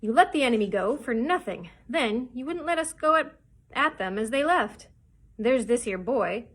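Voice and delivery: loud, forceful list of accusations; snarling. A narrator's reading. Mutineer listing the captain's failures, snarling. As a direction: hammer out each grievance. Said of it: "snarled George Merry".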